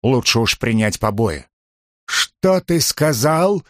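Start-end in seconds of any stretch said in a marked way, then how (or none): 2.1-3.6 higher-pitched, drawn-out indignant bark; mild, stretched-out narrated bark; barked question. A narrator's reading. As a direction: bark it slowly, pitch raised, without real shouting. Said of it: "рявкнул Целий".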